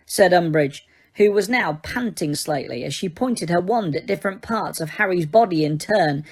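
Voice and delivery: plain reading voice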